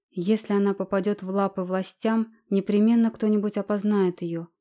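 ordinary delivery

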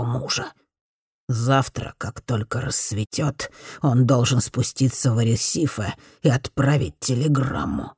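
put-on a hoarse old mob boss giving orders. A narrator's reading. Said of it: "прохрипел Дон Кентеро". (тихим хриплым голосом старого дона)

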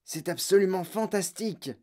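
understated breath-taken exclamation; gasped amazement. A narrator's reading. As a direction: marvel as an astonished grandfather. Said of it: "suffoqua grand-papa Joe".

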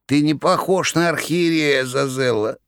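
high, strange cat voice. Acted. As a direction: sneer like a cat. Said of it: "заметил кот".

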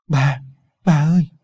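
put-on mild mewling child's call, swelling on the second cry; tearful whimper. A narrator's reading. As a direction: call out to grandma, a touch tearful. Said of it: "giọng mếu máo". (giọng mếu máo gọi bà)

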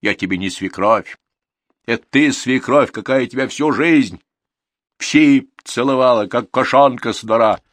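shrieking abuse. A narrator's reading. Old woman's shrieking abuse continues.

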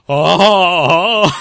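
high, loud excited oh oh; excited gasps. Retold or imitated. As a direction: gasp with fanboy excitement. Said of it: "el epílogo y yo".

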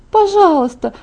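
high-pitched eager plea; excited begging. A narrator's reading. Friend bouncing with excitement, begging eagerly. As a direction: beg as if bouncing on the spot. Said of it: "подпрыгивала на месте от возбуждения".

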